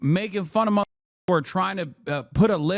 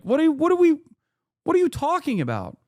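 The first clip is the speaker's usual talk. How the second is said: high-pitched, baffled outburst; incredulous exasperation; 9 semitones higher, 3 dB louder, faster than usual. Real, not acted. Ranter fed up with bench-press talk, incredulous. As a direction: stumble into a high, exasperated question.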